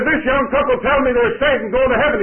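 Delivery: high, loud announcement to a crowd; announcing loudly. Retold or imitated. Old preacher announcing to the crowded coach, loud and high. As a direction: call it out to the whole coach.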